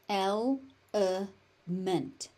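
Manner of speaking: slow "Element", el... e... ment; syllable by syllable. Shown as a demonstration. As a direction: separate each syllable of "Element". Teacher breaks word into syllables.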